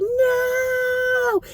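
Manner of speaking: high stretched slow-motion "No!"; slow-motion cartoon no. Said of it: "a cartoon where someone was like".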